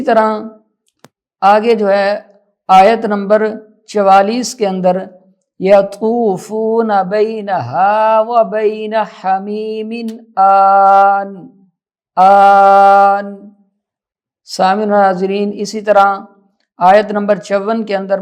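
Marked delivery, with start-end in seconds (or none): 5.6-13.3 slow tajweed, long held vowels; Quran recitation. Shown as a demonstration. Teacher demonstrating a verse, slow recitation. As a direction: recite slowly, holding the last word.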